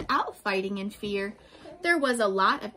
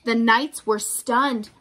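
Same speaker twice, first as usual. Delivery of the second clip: ordinary delivery, nothing marked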